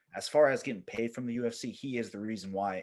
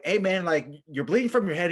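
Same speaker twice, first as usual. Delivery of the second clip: higher, louder retold warning; urgently flag the man down, acted out; alarmed call-out; 7 semitones higher, 6 dB louder than usual. Narrator to bleeding Russian pilot, acted alarm.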